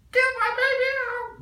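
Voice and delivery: high, loud frantic scream of a mother; panicked yell. Acted. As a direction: scream it like a desperate mom in labor. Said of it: "Mom's yelling".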